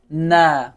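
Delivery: "نه" drawn out, tongue tip at upper gums; teacher's model pronunciation. Shown as a demonstration. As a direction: model a long, firm "نه" for pupils. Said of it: "از پشت من تکرار بکنین".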